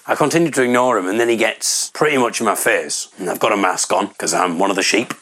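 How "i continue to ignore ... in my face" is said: ordinary talk, nothing marked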